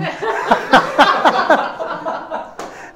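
airy, high chuckle; breathy laugh. Real, not acted. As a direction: laugh silently at your own joke. Laughs at own joke.

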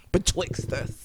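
low, soft, drawn-out BETWIXT; mock-fancy. Co-host playfully rewording "between us", mock-fancy. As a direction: say BETWIXT slowly, low and hushed, as a joke.

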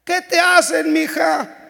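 hammed-up old lady calling out from the doorway. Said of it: "y le decía".

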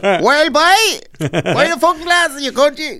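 put-on very high, sing-song Cork accent; thick Cork accent. Street seller heard you're from Cork, broad Cork accent. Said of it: "he just launches it".

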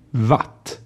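Watt with a German-style W, said "vat"; isolated "Watt", v at the start; Swedish pronunciation model. Shown as a demonstration. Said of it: "zwei Wörter mit W nachsprechen".

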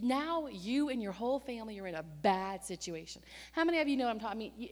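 usual speaking voice

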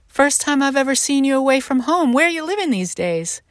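a hint of an eager old neighbor; voicing the old friend. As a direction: lightly lift into the friend's casual surprise.

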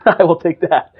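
slow, laughing concession; amused acceptance. Real, not acted. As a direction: laugh as you gladly accept the joke. Amused reply to joking verdict.

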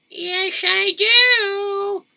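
high-pitched woman's reply; high wife voice. Acted. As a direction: squeak it out like the wife. Wife answers husband.

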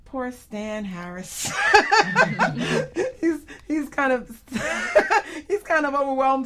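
slow words broken by high laughs; teasing the lone producer, laughing. Real, not acted. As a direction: laugh through it, fondly teasing. Laughing about overwhelmed colleague.

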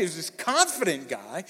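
normal talking voice

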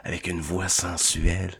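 low, slowed sultry tone; sensual voice. Acted. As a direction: purr it low and seductive. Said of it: "Je vais prendre ma voix".

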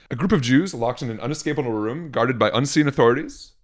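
normal talking voice

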